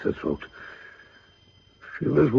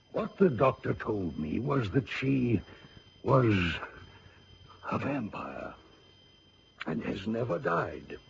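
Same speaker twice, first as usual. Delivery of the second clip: hushed reveal; 8 dB quieter than usual. Acted. General reveals vampire secret.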